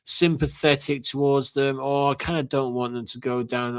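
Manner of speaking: high mocking voice of a sappy fan; mocking soft fan. Fan mocking rival fans' sympathy in a high voice. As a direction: mimic them high and wet. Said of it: "I see Arsenal fans saying".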